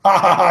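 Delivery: loud, high fake laugh; hammed-up loud cackle; mock laugh. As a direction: cackle at your own pun. Dumbledore laughs at own pun.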